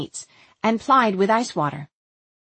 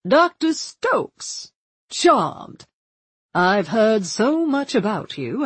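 put-on charming, genteel mid-Atlantic accent; courtly mid-Atlantic gentleman. A narrator's reading. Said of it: "in the sort of mid-Atlantic accent".

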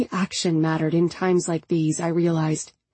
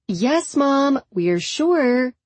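higher, louder call; 6 semitones higher, much slower than usual. A narrator's reading. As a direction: raise the voice to reach mom. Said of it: "And then louder".

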